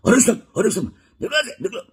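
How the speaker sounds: imitating a bullying official, loud high shout; mock a bandit barking orders to clear out; high-pitched, shouted bandit impression. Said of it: "tone जो था वो किसी डकैत का था".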